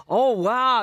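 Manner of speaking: playful impression of wowed Texans; imitating awestruck admirers. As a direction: put on a gushing, impressed reaction. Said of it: "they were".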